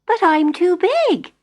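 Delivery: put-on a puzzled child protesting; Jenny worrying to the fairy, high and slow; little girl Jenny. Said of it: "said Jenny".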